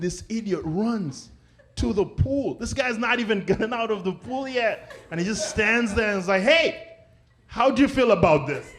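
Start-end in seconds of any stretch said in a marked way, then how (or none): 6.4-8.7 mock chirpy reporter. Journalist mocked.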